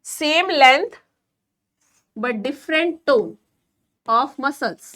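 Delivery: drawn out word by word for notes; slow dictation. Shown as a demonstration. Teacher dictates the flexion example for students' notes, very slow. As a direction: dictate it slowly, pausing between words.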